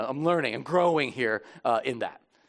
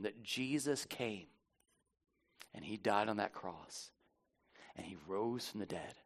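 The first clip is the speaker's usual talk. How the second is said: solemn gospel proclamation; 6 semitones lower, 11 dB quieter than usual. Real, not acted. Pastor reminding congregation of salvation, quietly solemn. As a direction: let each phrase settle softly. Recounts gospel before communion.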